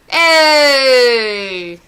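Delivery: ordinary talk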